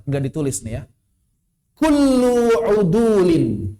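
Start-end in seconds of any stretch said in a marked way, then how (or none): 1.7-3.7 slow dictation of "Kullu udulin"; dictated slowly. Shown as a demonstration. Ustaz dictating an Arabic rule to the class, slow and spaced. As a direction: read each word out slowly so students can write. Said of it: "Saya diktekan coba".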